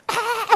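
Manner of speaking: high burst of laughing "아!"; 웃으며 높게 "아!"; laughing prayer cry. Retold or imitated. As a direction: mimic a giggling shout of joy in prayer. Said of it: "웃으면서 기도를 해".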